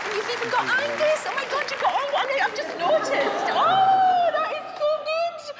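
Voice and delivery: very high, squealing delight; real excited cry rising into a loud, delighted "oh"; thrilled discovery. Real, not acted. Spots Angus in her portrait.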